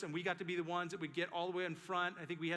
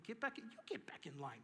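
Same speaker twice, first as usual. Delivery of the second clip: meek mock comeback; 5 semitones higher, 9 dB quieter than usual. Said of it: "I didn't say that".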